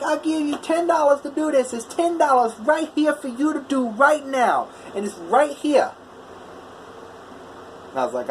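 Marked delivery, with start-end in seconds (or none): 0.0-5.9 high-pitched, insistent Boston-like customer imitation; thick Boston-like accent. Imitated customer haggling with welder, insistent thick Boston-like accent. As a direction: push the offer in a mock thick northern, Boston-like accent. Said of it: "in the really thick northern, almost Boston-like accent".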